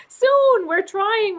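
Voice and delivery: high, loud bright burst; laughing excited. Real, not acted. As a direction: blurt it out with a laugh. Answering when they'll settle in.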